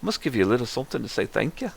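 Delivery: lilting auntie, swooping up in pitch; a wobbly attempt at a friendly village auntie; wandering auntie voice. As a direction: play a welcoming auntie, voice all over the place. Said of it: "that was all over the fucking place".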